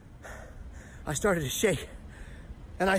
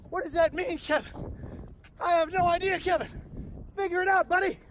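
escalating panic; 9 semitones higher than usual. Retold or imitated. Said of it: "my brain reacted again".

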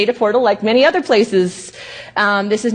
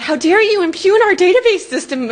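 mock offended indignation; 9 semitones higher than usual. Mocking offended tech support.

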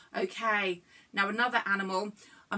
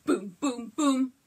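imitating Debs' booms; 4 dB louder, slower than usual. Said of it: "this is Debs' favourite bit".

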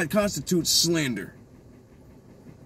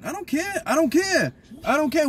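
heated higher-pitched repeated refusal; exasperated outburst; 9 semitones higher than usual. Real, not acted. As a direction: repeat it higher each time, fed up and heated.

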